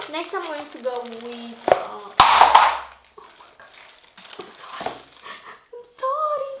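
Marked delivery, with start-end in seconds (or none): 1.7-3.7 breathy gasped oh my God, slow and startled; shocked gasp. Real, not acted. Something glass falls and breaks. 4.4-6.5 high, loud flustered sorry; startled apology. Real, not acted. Apologising after dropping glass.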